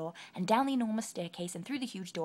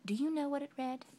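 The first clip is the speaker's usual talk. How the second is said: plain reading voice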